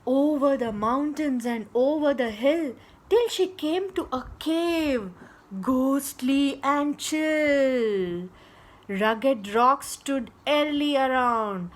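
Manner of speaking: loud, lilting read-aloud verse; story verse narration. A narrator's reading. Storyteller reciting a girl's mountain journey, loud verse narration. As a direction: draw out the rhyming words, projecting.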